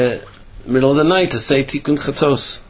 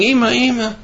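put-on crying children; 9 semitones higher than usual. Children crying for their mother.

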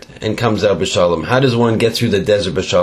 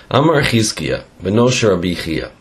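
ordinary delivery

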